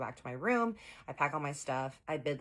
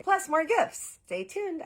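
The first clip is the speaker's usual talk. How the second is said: high, loud sign-off; excited teaser; 8 dB louder, much slower than usual. Real, not acted. Vlogger teases viewers about the next episode, high and loud. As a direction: hype up the next part. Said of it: "sign-off teaser to viewers".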